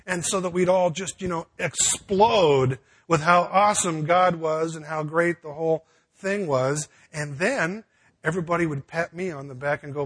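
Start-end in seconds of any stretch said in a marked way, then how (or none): none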